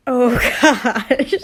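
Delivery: loud, laughing exclamation; helpless, loud laughter; cracking up. Real, not acted. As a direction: burst out laughing through the words. Breaking up laughing at the text.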